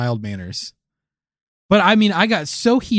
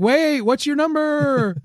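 mock flirt; 12 semitones higher, slower than usual. Flirting with the robot.

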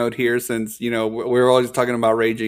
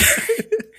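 high laugh. Real, not acted. Laughing during story.

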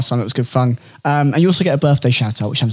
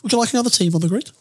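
lightly voiced impression, quick and higher at the end; a slightly hammed-up hopeful pitch to join; Michael Andretti; 8 semitones higher than usual. Offering another team for the grid, lightly hammed-up impression. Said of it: "It's a great Michael Andretti impression".

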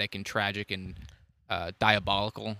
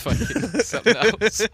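laughing; 10 semitones higher, 4 dB louder than usual. Real, not acted. Laughing at own mixup.

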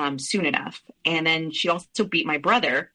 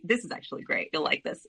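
ordinary delivery

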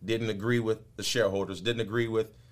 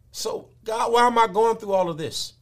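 complaining believer; 13 semitones higher, 7 dB louder than usual. Retold or imitated.

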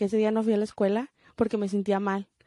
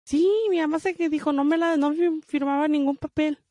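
higher, animated insistence; emphatic; 6 semitones higher than usual. Real, not acted. Daughter insisting her mom signed nothing, raised and animated. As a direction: push it up, indignant at mom.

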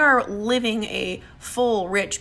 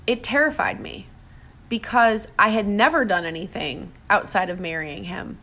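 ordinary talk

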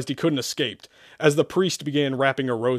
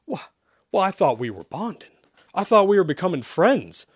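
higher, hurt-sounding complaint; sad; 7 semitones higher, 4 dB louder than usual. Acted. Isaac thought they were friends, saddened. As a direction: say it sadly, pitch lifting. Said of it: "Isaac replied sadly".

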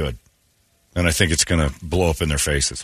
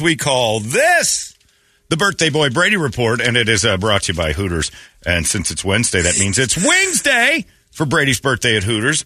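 punchy morning-radio promo delivery; radio segment announcer; 5 semitones higher than usual. Acted. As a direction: sell the segment big, stretching the big words. Announces segment and reads sponsor ad.

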